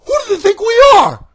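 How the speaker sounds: angry shout. Real, not acted. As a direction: yell the question at them. Ranting at WWE booking.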